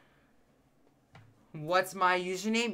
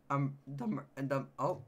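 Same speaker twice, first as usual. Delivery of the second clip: voice cracking; 4 semitones lower, 7 dB quieter than usual. Real, not acted. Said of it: "my voice cracked".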